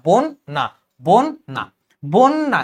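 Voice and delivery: "বন্যা" as bon, brief stop, na; stopping mid-cluster. Shown as a demonstration. Teacher showing where to stop, "বন্যা" split bon - na. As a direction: break "বন্যা" between the two n sounds. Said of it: "আমি একবার থামতেছি".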